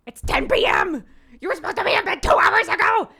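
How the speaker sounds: high, loud ranting shout; tantrum yell. Pretend. Mom pretends to rant at daughter about bedtime, tantrum yell. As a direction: throw an adult tantrum. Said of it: "immediate reaction wants to be like".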